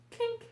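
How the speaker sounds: a high squeaky spoken clink; playful toast clink; mock clink. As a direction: make the glass sound with your voice. Mimics glasses clinking in toast.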